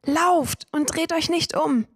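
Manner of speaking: high, drawn-out shouted warning; father's desperate shout. A narrator's reading. Father yells again to his fleeing children to keep running. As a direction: scream it again as the memory repeats. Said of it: "schrie mein Vater wieder".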